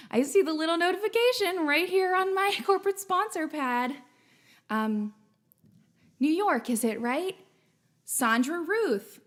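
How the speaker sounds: ordinary delivery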